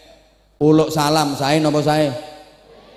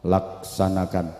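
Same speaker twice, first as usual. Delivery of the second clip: slow, low, soft order; calm, relaxed reply; 8 semitones lower, 4 dB quieter than usual. Acted. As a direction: answer the shouting commander unruffled and low. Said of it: "jawabnya kalem, santai".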